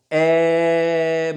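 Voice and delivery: ए held out long on one steady note; long held vowel. Shown as a demonstration. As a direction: stretch ए as long as you like. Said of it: "मैं कितनी भी देर तक बोल सकता हूँ". (लंबा खींचकर बोला गया ए)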